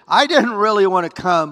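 high, loud, whiny mock complaint; grudging Ananias. Preacher mocks Ananias arriving at Saul's house, whiny reluctance. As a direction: play Ananias as a reluctant errand boy. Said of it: "that's not what he did".

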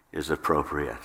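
usual speaking voice

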